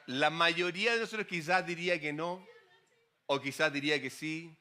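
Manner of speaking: ordinary talk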